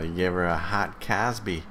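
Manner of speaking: normal talking voice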